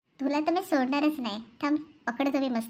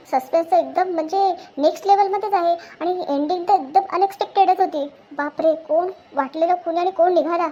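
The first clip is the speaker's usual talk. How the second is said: loud, raised-pitch amazement; amazed excitement; 4 semitones higher, 6 dB louder than usual. Acted. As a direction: rave about the twist ending with force. Amazed by the film's ending.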